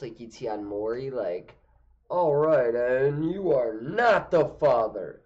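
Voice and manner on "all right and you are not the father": talk show host reveal. Retold or imitated. Maury-style paternity reveal parody.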